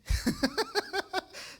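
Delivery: a high burst of embarrassed laughter; sheepish laugh. Real, not acted. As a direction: laugh at yourself for dozing off. Laughs at falling asleep praying. (risa avergonzada y aguda)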